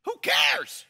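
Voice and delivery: high, loud cry of disbelief; hammed-up who cares; mock exasperation. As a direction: throw it out incredulously. Mocking the cushion detail.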